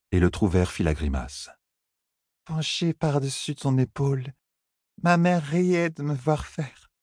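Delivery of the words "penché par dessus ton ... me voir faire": high, slow, slurred words; slurred drunk Elaine. Acted. Elaine tugging Tom's moustache, slow, slurred and high. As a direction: drag the words out like a tipsy girl.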